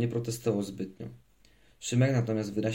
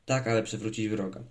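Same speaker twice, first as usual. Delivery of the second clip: ordinary delivery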